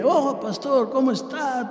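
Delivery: high-pitched drunken greeting, imitated; high, mock tipsy hello; talkative drunk. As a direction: act out an overfamiliar drunk hailing his pastor. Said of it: "borracho, hablador".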